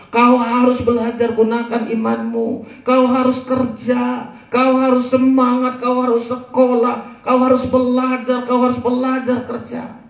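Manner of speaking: put-on preacher urging parents to make children stand alone, commanding; forceful preaching. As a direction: drive every line home to the congregation.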